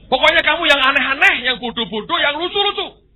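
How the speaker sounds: high, loud command; booming God. Acted. God loudly tells the monkey to be silly. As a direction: insist on it from above.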